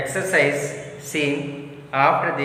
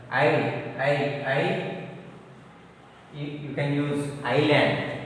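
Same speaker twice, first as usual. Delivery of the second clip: normal talking voice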